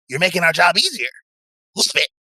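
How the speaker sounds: high-voiced, gloating goon; gloating henchman.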